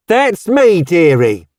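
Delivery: high, bright-hearted Cockney charwoman; cheerful Cockney. A narrator's reading. Cheery Cockney neighbour greeting Roseanne at the door, high and hearty Cockney. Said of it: "replied a cheerful Cockney voice".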